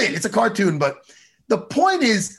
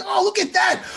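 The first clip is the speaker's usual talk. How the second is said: delighted recognition; 8 semitones higher than usual. Retold or imitated. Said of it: "I'm like".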